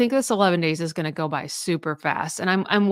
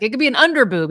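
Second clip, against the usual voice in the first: higher and louder, hammed-up eager tone; mock excited guy; 6 semitones higher, 7 dB louder, faster than usual. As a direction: play the overeager husband, a bit over the top. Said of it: "He's like".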